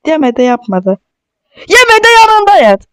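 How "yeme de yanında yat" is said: playful squeal. Pretend. Mock scolding game character.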